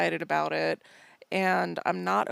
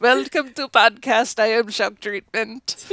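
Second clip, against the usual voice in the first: loud put-on voice with laughter; goofy announcer voice, cracking up; silly mock intro; 7 dB louder than usual. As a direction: send up your own botched greeting, loudly. Mocks own botched intro, laughing.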